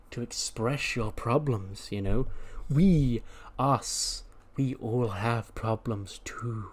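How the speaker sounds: slow, softly measured counsellor voice; soothing reassurance. Acted. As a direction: coax gently, drawing out the words. Voicing a soothing safe-space cult leader.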